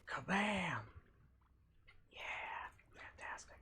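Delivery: excited whisper. Real, not acted. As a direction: whisper the triumph. Whispered exclamations while playing.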